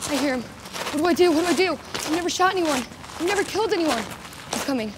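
terrified as the enemy comes closer; rising panic. Acted. Enemy approaching, she panics.

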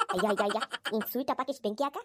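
ordinary talk

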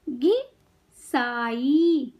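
घिसाई very slowly, pause after घि, long साई; syllable by syllable. Shown as a demonstration. Teacher teaching kids to read, syllables apart. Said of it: "शब्द पढ़ना सिखाऊंगी".

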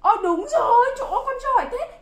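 delighted teacher praise; excited praise. Real, not acted.

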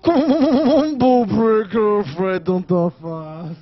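slow, drawn-out announcer-style gloat; triumphant taunt. Real, not acted. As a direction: stretch it out like a fighting game announcer. Gloating over winning the fight.

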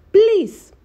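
a high, loud, drawn-out please; pleading please. Retold or imitated. Retelling the father-in-law begging Queen May, a high loud plea. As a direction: beg like an old father.

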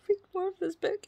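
laughing, high and whiny. Real, not acted. Vlogger to viewers, laughing that she wants to keep reading. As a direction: laugh through the guilty wish. Laughing at own bad choice.

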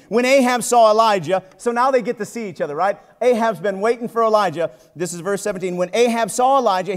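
normal talking voice

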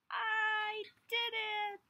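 high, slow, loud joy; triumphant. Acted. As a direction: savour the victory. Seed triumphant.

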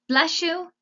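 high, loud blessing; loud, high-pitched call; shouting. A narrator's reading. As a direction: shout it heartily after a sneeze. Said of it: "shouted Meg".

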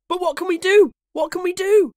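very high, loud helpless wail; panicked cry. A narrator's reading. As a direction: cry out as frightened boys. Boys panic before wolves.

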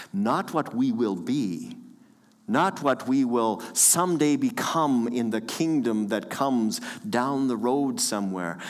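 ordinary delivery

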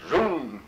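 loud high zoom sound effect; excited sound of a dash; whooshing zoom. Retold or imitated. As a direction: shout it like something rushing in. Sister rushing at him.

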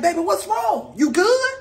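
a hint of anxious father checking on baby; concerned parent. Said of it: "I'm like".

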